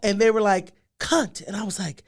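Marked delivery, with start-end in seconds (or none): 1.0-1.4 with conviction. Retold or imitated. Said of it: "you say it like, with, with conviction".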